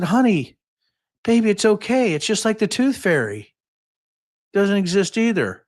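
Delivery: high, sing-song reassurance; high mock-tender consoling; soothing dad. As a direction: gently break bad news to a child. Dad consoling daughter, retold.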